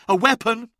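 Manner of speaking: high, loud startled question; alarmed cry. A narrator's reading. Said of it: "cried Sobek".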